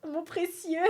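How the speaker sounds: lightly voiced creature croon; a hint of a creature coveting its treasure; quick film-creature imitation; Gollum. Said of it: "on dirait Gollum".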